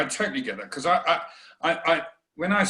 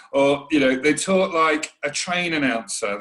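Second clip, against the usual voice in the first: ordinary talk, nothing marked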